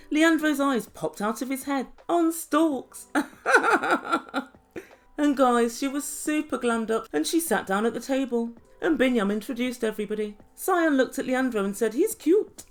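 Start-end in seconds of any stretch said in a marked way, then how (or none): 3.1-4.4 high, rippling laugh; hearty laugh. Real, not acted. Narrator tickled by eyes out on stalks, hearty laugh. 11.9-12.6 lightly acted, higher-pitched compliment; mock admiring. Cyan looks at Leandro, mock admiring.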